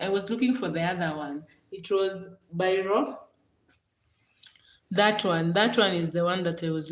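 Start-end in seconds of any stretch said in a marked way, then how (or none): none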